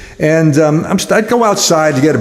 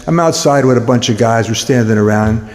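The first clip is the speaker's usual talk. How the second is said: ordinary talk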